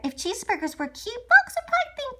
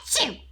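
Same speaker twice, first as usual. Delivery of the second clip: fake sneeze; 2 semitones lower than usual. Shown as a demonstration. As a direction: act out a big achoo. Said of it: "You know, kinda like that".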